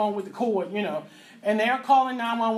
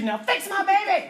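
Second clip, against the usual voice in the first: higher, slower angry yell; screaming demand; 9 semitones higher, 4 dB louder than usual. Retold or imitated. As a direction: demand it at the nurses, furious. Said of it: "And I'm screaming".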